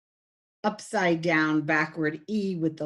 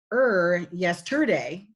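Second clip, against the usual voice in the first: ordinary talk, nothing marked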